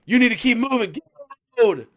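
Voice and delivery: high, loud mock cop order; imitating the cop. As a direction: order her off the street. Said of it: "the police officer comes up".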